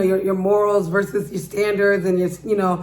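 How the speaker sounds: normal talking voice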